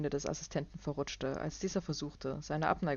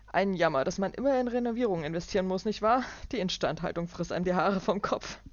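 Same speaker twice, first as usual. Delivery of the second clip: loud, higher, falsely kind; condescending businessman; 4 semitones higher, 5 dB louder than usual. Acted. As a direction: drip false pity. Clark's voice.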